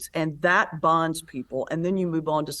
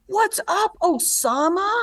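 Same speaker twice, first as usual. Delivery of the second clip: put-on high jeering voice of a young heckler; mocking taunt; 13 semitones higher, 3 dB louder, slower than usual. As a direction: sneer it out the truck window, much higher. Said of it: "leans out and goes".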